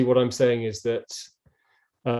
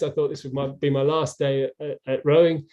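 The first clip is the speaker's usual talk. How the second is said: ordinary talk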